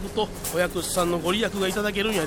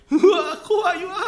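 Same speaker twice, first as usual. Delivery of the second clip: scared cry; 9 semitones higher, 6 dB louder than usual. Acted. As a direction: wail it out in fear. Entering the dark.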